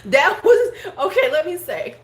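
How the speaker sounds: loud, high laughing words; loud laughing exclaim. Real, not acted. Laughing at comment.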